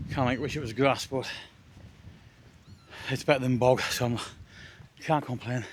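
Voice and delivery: ordinary delivery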